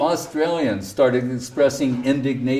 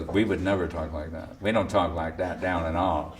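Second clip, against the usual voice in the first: low, softer mock Australian protest; mock Australian voice, lower and softer; mock Aussie denial; 7 semitones lower, 7 dB quieter than usual. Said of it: "They go".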